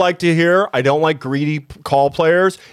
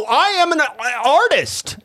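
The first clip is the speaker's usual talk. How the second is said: pompous artist; 8 semitones higher than usual. Acted. Pompous reply to teasing.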